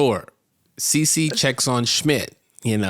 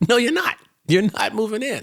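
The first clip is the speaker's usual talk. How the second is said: high, insistent refusal; loud, hammed-up disbelief; mock outrage; 11 semitones higher, 3 dB louder than usual. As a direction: play an indignant veto, pitched way up. Continues acted outraged refusal.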